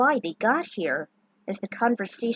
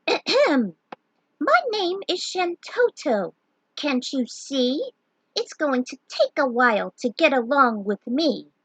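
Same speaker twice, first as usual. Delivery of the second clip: slow, haughty, higher little mage; 6 semitones higher, 3 dB louder, slower than usual. A narrator's reading. Tiny mage introducing herself, haughty and slow. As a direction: drawl it out, full of yourself.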